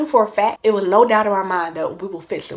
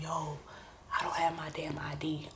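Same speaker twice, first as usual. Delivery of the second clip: whispered, low and under the breath; hushed panic; 3 semitones lower, 15 dB quieter than usual. Retold or imitated. Panicking alone while sister is across the suite, hushed whisper. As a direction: keep it down so nobody overhears. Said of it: "trying to be quiet".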